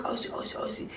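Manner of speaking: urgent whispered call at the door; hushed calling through a door. Retold or imitated. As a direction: whisper so nobody in the house hears. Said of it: "I don't wanna make too much noise".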